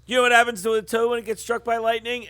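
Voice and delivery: fast, campy, corny delivery; campy line reading. Retold or imitated. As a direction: say it corny and quick. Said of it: "supposed to be campy and stupid".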